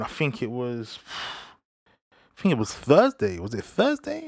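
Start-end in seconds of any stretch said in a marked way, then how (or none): 3.4-4.2 unsure self-question. Real, not acted. Doubting himself mid-story.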